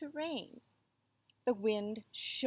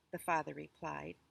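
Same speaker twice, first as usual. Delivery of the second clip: ordinary delivery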